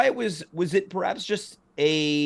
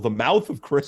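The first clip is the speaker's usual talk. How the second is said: normal talking voice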